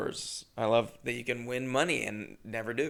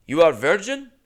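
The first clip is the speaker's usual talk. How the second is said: put-on louder, blunt Indian-accented question; Indian accent impression; 5 semitones higher, 8 dB louder than usual. Clerk bluntly asking the comic, Indian accent. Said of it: "unfortunately, keep doing it".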